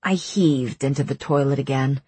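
ordinary delivery, nothing marked

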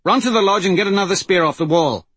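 quick, high, loud command; shouted order. A narrator's reading. Wraith sends Malcolm running for a spear, shouted order. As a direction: fire off urgent instructions. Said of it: "Wraith shouted".

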